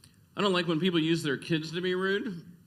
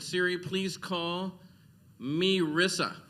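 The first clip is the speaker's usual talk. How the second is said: slow phone command; slower than usual. Retold or imitated.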